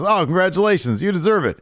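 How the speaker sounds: high, loud excited praise; mock gushing congratulations. Wrestler congratulating the new champion, high mock excitement.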